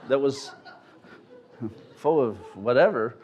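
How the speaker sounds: normal talking voice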